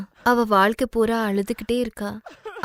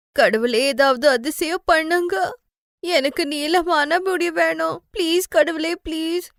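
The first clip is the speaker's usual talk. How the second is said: put-on much higher, slow begging girl's voice; pleading prayer; 7 semitones higher than usual. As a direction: beg God for a miracle like a small girl. Girl prays at night. (மிக உயர்ந்த குரலில் கடவுளிடம் கெஞ்சும் சிறுமி)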